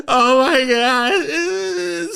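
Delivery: hammed-up high sobbing wail; re-enacted loud blubbering; crying. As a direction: wail it long and slow through mock tears. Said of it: "So I'm crying".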